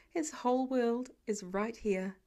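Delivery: ordinary delivery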